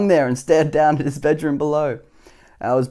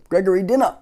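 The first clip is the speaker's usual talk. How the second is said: mother calling up, mildly raised; mild shout; 6 semitones higher, 4 dB louder than usual. A narrator's reading. Mother calls her son to dinner, mild mock shout. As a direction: call him down again, a bit insistent. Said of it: "his mother shouted".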